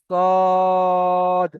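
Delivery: সদ with a thick held vowel; long sad. Shown as a demonstration. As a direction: draw out the vowel for three alifs. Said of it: "তিন আলিফ পরিমাণ টান হবে". (তিন আলিফ টেনে সদ)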